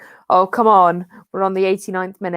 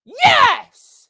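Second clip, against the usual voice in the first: loud, high screamed cheer; real celebratory yelling at the match; triumphant shout; 8 dB louder, much slower than usual. Real, not acted. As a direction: roar it out, stretching each shout. Cheering live match action.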